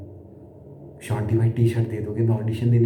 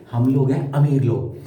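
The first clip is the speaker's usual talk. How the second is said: usual speaking voice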